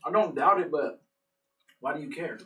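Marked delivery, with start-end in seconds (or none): none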